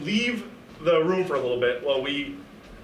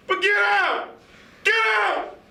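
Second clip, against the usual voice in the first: much higher, slow hammed-up yell; mock yell; slower than usual. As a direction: shout him out of the room. Kicking out host, shouting.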